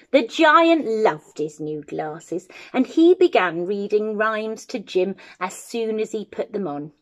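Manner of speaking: usual speaking voice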